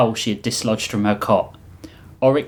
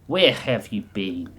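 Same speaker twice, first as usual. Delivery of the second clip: put-on slow, loosely Russian accent; vaguely Russian accent; 2 semitones lower than usual. The Reap talks as if to no one, slow Russian accent. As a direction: take it slowly in a Russian accent. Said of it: "thick veil accent that was vaguely Russian".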